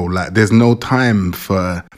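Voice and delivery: usual speaking voice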